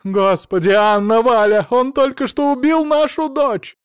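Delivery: very high, loud outcry; horrified screaming. A narrator's reading. Mother-in-law cries that he killed their daughter, hysterical scream. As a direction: scream the news to everyone. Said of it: "закричала".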